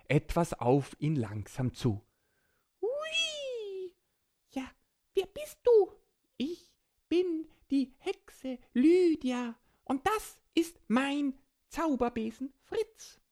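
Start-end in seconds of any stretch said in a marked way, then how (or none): 2.8-5.9 high squeaky little creature voice; small child voice. Acted. Borki greets the flyer. 6.4-13.2 slow, raised friendly witch. Acted. Witch Lydia introduces herself.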